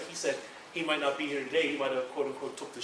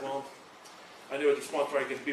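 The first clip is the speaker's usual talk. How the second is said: ordinary delivery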